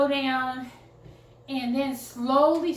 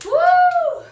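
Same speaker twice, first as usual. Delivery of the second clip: high, loud whoop of relief; triumphant whoop; 11 dB louder than usual. Real, not acted. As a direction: let out a big victory whoop. Finishing the hard set.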